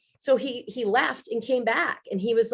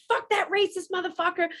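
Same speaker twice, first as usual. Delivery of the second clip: higher, mock drunken outburst; retold tipsy rant; 8 semitones higher than usual. Woman retelling her tipsy rant about the neighbor, mock angry. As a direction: relive the driveway rant, half joking. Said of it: "I was flipping out in my driveway".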